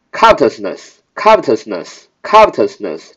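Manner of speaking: covetousness with the weight on CO, said slowly; stress on the first syllable. Shown as a demonstration. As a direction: lean on the front of covetousness, unhurried. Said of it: "重音还在前面". (重音放在covetousness的第一个音节)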